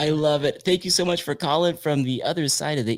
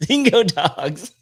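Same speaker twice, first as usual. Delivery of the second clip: laughing repeat of dingo dogs; 6 semitones higher, 6 dB louder, slower than usual. Real, not acted. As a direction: say it through a laugh. Host laughs repeating caller.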